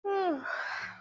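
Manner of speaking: long yawning sigh; big yawn. Real, not acted. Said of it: "your lungs need more oxygen".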